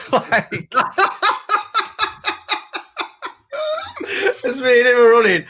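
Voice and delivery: can barely get it out for laughing; laughing hard. Real, not acted. Laughing at the funny running story.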